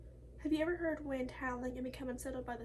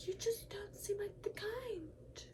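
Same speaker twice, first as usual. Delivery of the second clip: a mock Karen, higher and half-whispered; 7 semitones higher, 5 dB quieter, slower than usual. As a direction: sneer it like a snooty Karen. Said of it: "racist Karens".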